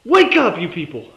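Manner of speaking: a loud plea to wake up; exasperated shout. Real, not acted. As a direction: call out loud to the audience. Ranting at fellow viewers.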